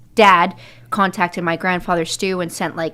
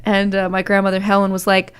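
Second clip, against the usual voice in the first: usual speaking voice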